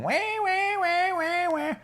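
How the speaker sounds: high, thin, soft siren-like "wah"; mocking whine. Storyteller mimics the complaining women for children, mocking whine. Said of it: "they're used to whining".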